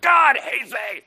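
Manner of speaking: put-on high, slow, loud childish wail; sulky Jonah. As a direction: whine it like a pouting child.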